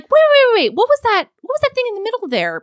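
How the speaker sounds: high, loud startled wait wait; shocked interruption. Retold or imitated. She cuts him off, startled by his name. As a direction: stop him mid-sentence, startled.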